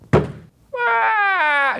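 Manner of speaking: long, very high sustained wail; mock kid's shriek held on one high note; imitated child's scream. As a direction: squeal like an upset little one. Imitating kids screaming in nursery.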